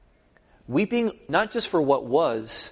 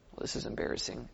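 whispered aside, much softer; embarrassed whisper; 5 semitones lower, 14 dB quieter, faster than usual. Pretend. Disciples whispering after the failed exorcism, embarrassed whisper. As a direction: mutter it under your breath in embarrassment.

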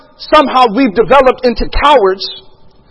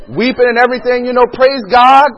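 ordinary talk

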